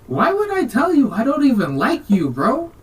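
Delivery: loud, high mock outburst; hammed-up indignant brush-off; indignant rejection. Acted rejection in retold quarrel.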